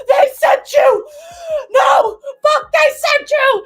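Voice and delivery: high, loud, slow frantic yell; paranoid screaming. Retold or imitated. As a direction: shriek in paranoid panic. Concussed scientist panicking.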